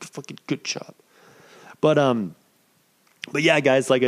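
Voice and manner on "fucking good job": whispered to himself. Shown as a demonstration. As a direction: whisper the praise under your breath.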